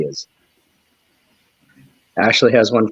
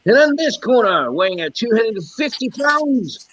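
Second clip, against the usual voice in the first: fight announcer; 10 semitones higher than usual. Pretend. Streamer announcing a mock cage fight with a kangaroo, ring announcer voice.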